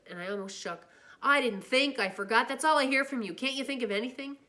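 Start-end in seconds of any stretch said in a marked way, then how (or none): 1.2-4.4 loud, higher angry tirade; furious shout. Acted. Older brother shouting at the boy, furious. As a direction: unload every frustration. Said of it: "Derry shouted".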